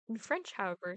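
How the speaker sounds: normal talking voice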